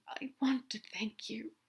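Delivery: whispered murmur, a bit slow; hushed tender murmur into a neck. Acted. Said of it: "Aziraphale murmured into Crowley's neck".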